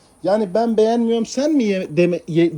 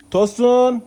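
a raised, lifted call of Tosun; calling the cat; 3 semitones higher, 4 dB louder than usual. Real, not acted. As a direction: call him from afar.

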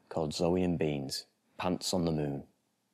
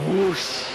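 loud, higher rushing whoosh; 13 semitones higher, 6 dB louder than usual. Acted. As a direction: blast the word like a jet passing. Aeroplane rushing past.

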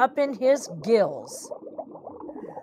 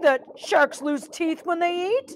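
higher, hesitant little fish voice; nervous stalling; 7 semitones higher than usual. Acted. Birdie stalls the hungry shark, higher nervous voice. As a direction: start hesitantly, pitched up.

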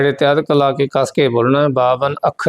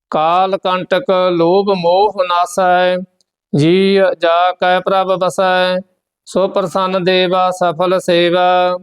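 steady, measured chant of the verses; scripture recitation; 4 semitones higher, slower than usual. Acted. Teacher recites Gurbani verses for the students, steady and measured. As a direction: recite each line evenly, with devotion.

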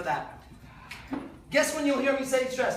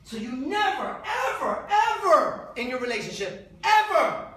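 ever climbing, last ever higher and louder; stress on ever; 3 semitones higher, 4 dB louder than usual. Real, not acted. Warning men about relationship talk, last ever punched higher. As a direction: build the ever ever ever to a peak. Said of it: "emphatic warning".

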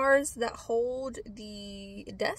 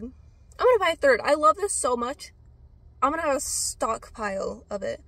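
gushing excitement; 2 semitones higher, 8 dB louder than usual. Retold or imitated. Recalling her excitement over the vitamin C serum: gushing. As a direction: burst out like a delighted fan. Retelling loving the product.

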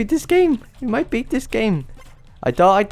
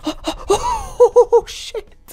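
very high, drawn-out laughing squeal; overwhelmed elation; slower than usual. Real, not acted. Gamer beating the last level, high elated squeal. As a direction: squeal in stunned delight.

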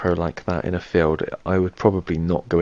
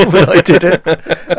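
laughing; 9 semitones higher, 6 dB louder, much slower than usual. Real, not acted. Laughing at own impression.

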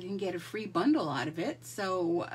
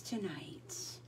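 whispered; 4 semitones lower, 12 dB quieter than usual. Real, not acted. Playful whispered sign-off word.

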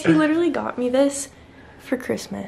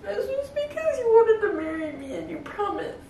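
higher, wobbling with tears and laughter; crying protest; 6 semitones higher than usual. Real, not acted. Girlfriend pleading with him through tears and laughter.

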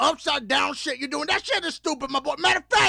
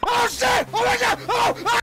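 high, loud panicked cry; startled yelp; 9 semitones higher, 4 dB louder than usual. Acted. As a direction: shout as you fall. Falling, screaming.

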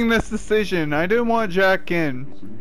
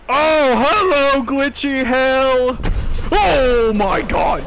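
louder, drawn-out startled cry; startled outcry; 4 semitones higher, 3 dB louder, slower than usual. Real, not acted. Streamer startled in a glitchy hallway, drawn-out cry. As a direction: cry out at the sudden thing. Scare while walking the hallway.

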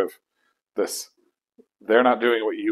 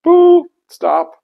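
sudden self-interrupting stop; 13 dB louder than usual. Retold or imitated. Pulling self out of spiral.

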